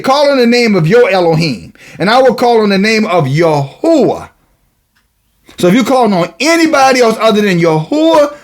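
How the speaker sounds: high, ringing scripture declamation; preaching. Real, not acted.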